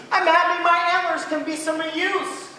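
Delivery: high, loud cheerful moose voice; a generous moose welcoming his guest; big-hearted moose. Acted. Fidwick speaks to bug.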